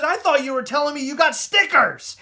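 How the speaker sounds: mildly confused wife; confused Heather. Retold or imitated.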